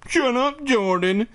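very high mimicking voice; mocking repetition. A narrator's reading. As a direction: parrot a sister in a squeaky whine. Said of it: "Jordan repeated".

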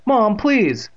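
high, loud appeal; pleading. A narrator's reading. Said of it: "he pleaded".

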